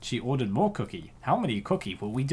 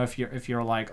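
usual speaking voice

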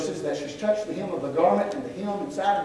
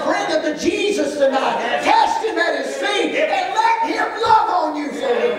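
full-force preaching; 8 semitones higher, 3 dB louder than usual. Acted. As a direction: lift the congregation with a ringing promise.

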